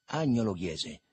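usual speaking voice

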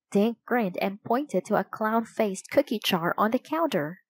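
plain reading voice

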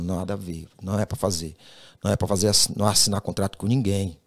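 a calm, low, quiet divine voice; God's voice. Retold or imitated. Retold God speaking to him. (voz de Deus, mais grave e baixa)

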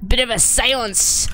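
usual speaking voice

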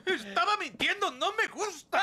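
blubbering out the truth; tearful. Acted. Son confesses in tears.

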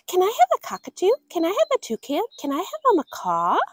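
put-on high, quick begging child; eager pleading. Girl pestering her parents, high eager pleading. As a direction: rattle off the asks like a pleading kid.

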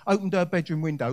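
usual speaking voice

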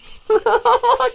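high, strained laugh of disbelief; exasperated laugh. Real, not acted. Streamer laughing at dying again. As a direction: laugh in helpless frustration. Frustrated gamer losing repeatedly.

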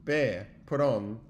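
ordinary delivery

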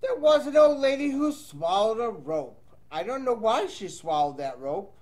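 lively read-aloud storytelling voice; reading to children. Acted. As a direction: read the rhyme to young kids. Teacher reads picture book aloud.

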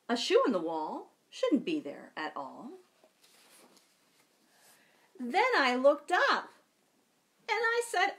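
put-on lively, slow read-aloud for children; storytime reading. A narrator's reading. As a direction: read it like a picture book to kids. Reads picture book aloud.

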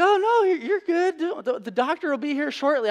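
very high, sweet imitation of the nurse; mock a chirpy nurse calming him down; reassuring nurse. Nurse soothing the worried patient, in a high chirpy voice.

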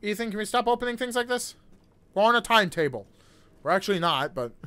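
ordinary talk, nothing marked

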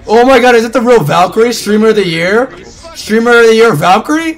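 high, loud excited fan; starstruck. Real, not acted. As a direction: gush like a fan. Starstruck by a streamer.

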